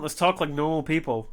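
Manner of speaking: usual speaking voice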